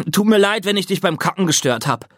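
put-on older brother teasing George at the kitchen table; teasing big brother. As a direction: tease her lightly.

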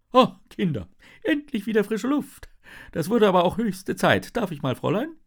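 put-on a bottle spirit relieved to be free, greeting the kids; old-fashioned genie with a moustache, cheerfully relieved.

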